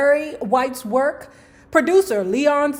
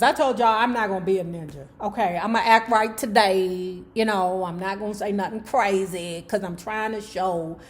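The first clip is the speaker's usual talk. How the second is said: ordinary delivery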